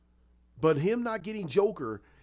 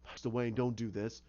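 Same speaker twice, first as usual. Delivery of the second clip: quiet plea; 6 semitones lower, 6 dB quieter than usual. Retold or imitated. Alfred pleading, retold.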